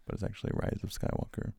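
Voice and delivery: whispering. Real, not acted. Whispered aside.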